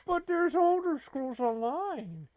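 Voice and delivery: high, put-on little cat voice pleading; a kitty begging its owner not to switch games; sad kitty voice. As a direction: sulk like a neglected pet cat. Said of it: "And he's like".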